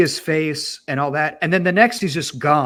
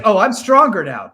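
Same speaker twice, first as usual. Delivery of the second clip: louder, higher smug boast; cocky boast; 5 semitones higher, 10 dB louder than usual. Retold or imitated. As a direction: brag it loud and cocky. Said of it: "he's always like".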